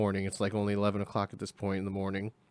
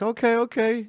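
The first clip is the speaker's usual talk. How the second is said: uneasy caution; 12 semitones higher, 6 dB louder than usual. Retold or imitated. Passenger uneasy as speed rises.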